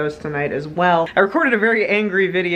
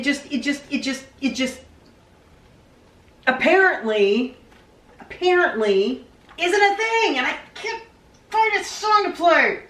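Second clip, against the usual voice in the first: whiny complaint; 9 semitones higher than usual. Real, not acted. Whining about lessons.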